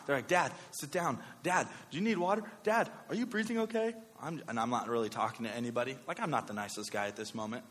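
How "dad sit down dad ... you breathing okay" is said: put-on higher-pitched worried kids; rising, concerned child voice; concerned children. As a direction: imitate kids fussing over their dad. Children fussing over panicking dad.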